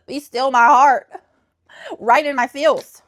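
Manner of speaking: clutch your heart and swoon slowly; mock swoon. Real, not acted. Booktuber swooning over a dedication, slow gasping swoon.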